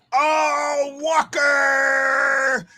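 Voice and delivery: hugely stretched, high shout; long, held cheer on the name; celebratory shout. Real, not acted. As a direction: draw the name out like a goal call.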